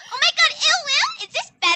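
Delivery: high, bratty mock girly voice; mock kid voice. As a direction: squeal it like a mocking little girl. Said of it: "You want me to sound like a kid?".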